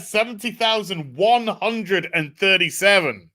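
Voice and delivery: high, drawn-out jubilant announcement; real celebration. Real, not acted. Host celebrating the seventy thousand total, jubilant. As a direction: proclaim the total slowly with rising excitement.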